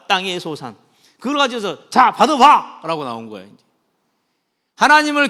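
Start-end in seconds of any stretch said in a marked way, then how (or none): 1.9-2.8 loud, high "here, take it!"; defiant offering. Retold or imitated. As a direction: shove it at him defiantly. Cain thrusting his offering.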